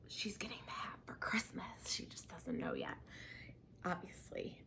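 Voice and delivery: whispered aside, soft and conspiratorial; secret whisper. Real, not acted. Telling viewers mom's Christmas gift, whispering. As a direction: whisper it so mom won't find out.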